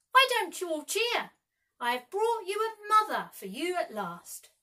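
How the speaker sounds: high, loud joyful call; cried out. A narrator's reading. Peter calls to the Lost Boys, high and joyful. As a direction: announce your return with delight.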